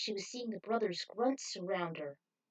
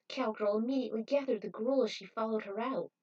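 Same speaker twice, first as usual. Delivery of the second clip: usual speaking voice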